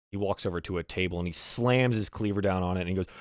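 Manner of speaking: ordinary talk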